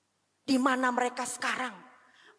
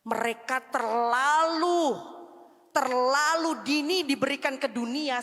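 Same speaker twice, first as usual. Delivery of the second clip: slow, rising, louder preaching; building sermon; 3 semitones higher, 4 dB louder, slower than usual. Acted. Preacher warning parents about money and pride, building sermon. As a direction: build it line by line.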